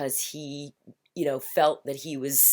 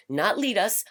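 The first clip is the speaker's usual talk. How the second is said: ordinary talk, nothing marked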